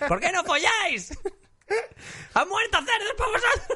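put-on high drunken shouting through laughter; drunk yelling outside. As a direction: yell at the pigs like a drunk. Drunk Noah yelling at pigs.

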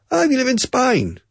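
very high, with Spain said as spine; a hammed-up Aussie voice; Australian accent. Teacher imitating an Australian speaking to him, high mock Australian. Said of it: "I can't speak like Aussies".

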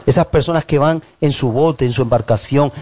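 usual speaking voice

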